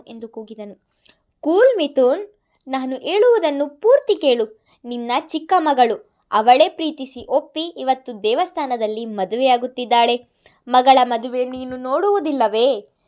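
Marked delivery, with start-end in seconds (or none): 1.4-13.0 louder, mocking villain on the phone; taunting villain. A narrator's reading. Madan taunting Mithun over the phone, loud and mocking. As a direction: needle him with each word.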